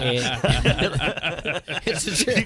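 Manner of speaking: laughing through the words; can't hold back the laugh; giggling while telling the story. Real, not acted.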